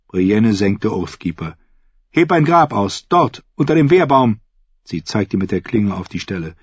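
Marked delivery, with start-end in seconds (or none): 2.1-4.4 raised, higher-pitched order; stern command. A narrator's reading. Brienne orders the fool to dig, stern command. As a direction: order your captive to work.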